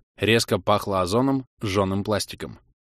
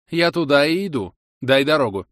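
curt, overbearing command; too harsh; 5 semitones higher, 4 dB louder than usual. A narrator's reading. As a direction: brush him aside brusquely. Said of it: "переборщила с резкостью тона". (резко, свысока)

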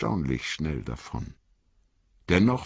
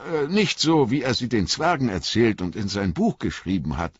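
put-on higher, lighter young hobbit voice; earnest young hobbit, pitched up; young Frodo; 7 semitones higher than usual. A narrator's reading. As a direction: lift the voice for eager young Frodo.

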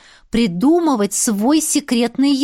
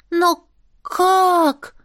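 put-on high child voice; 7 semitones higher, 3 dB louder than usual. Pixie boy asks.

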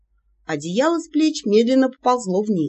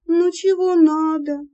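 slow, drowsy, just woken; sleepy voice; 9 semitones higher, slower than usual. Acted. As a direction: mumble it half asleep. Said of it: "сонным голосом спросила".

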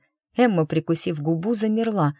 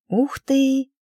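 bright, much higher cry of delight; delighted gasp; 9 semitones higher than usual. Acted. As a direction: gasp in sincere admiration. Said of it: "искренне восхитилась девушка". (восхищённо, высоко)

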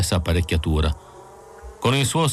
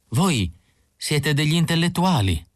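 higher, thrilled simple man; excited Isidor; 7 semitones higher than usual. A narrator's reading. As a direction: blurt it out with pride, higher. (Isidor emozionato e fiero, voce più acuta)

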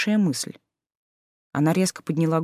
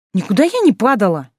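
louder, higher hurt protest; indignant protest; 4 semitones higher, 6 dB louder than usual. Acted. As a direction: snap back defensively.

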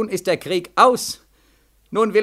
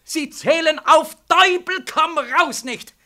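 higher, louder, slow outburst; frantic outcry; 10 semitones higher, 4 dB louder, slower than usual. Acted. Soldier cries out to the colonel that the dead will not count, high and loud. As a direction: fling it out in despair.

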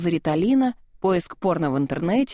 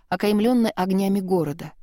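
plain reading voice

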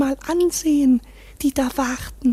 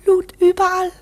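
excited; 3 semitones higher, 5 dB louder than usual. Acted. Frenzied fantasy climax.

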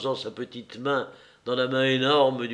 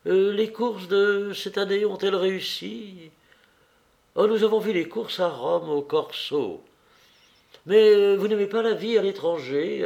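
higher, lightly acted hostess voice; sociable hostess, making conversation; 7 semitones higher, 3 dB louder than usual. A narrator's reading. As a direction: keep the guest chatting, pitched up. Narrator voices Anna chatting. (hôtesse mondaine, voix plus aiguë)

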